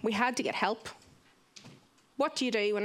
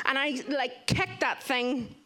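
ordinary talk

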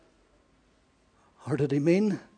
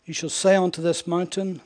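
ordinary talk, nothing marked

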